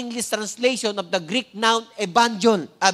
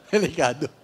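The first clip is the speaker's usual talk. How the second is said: chuckling through the word; laughing; 5 semitones lower than usual. Real, not acted. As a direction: laugh while repeating it. Laughing at his own joke.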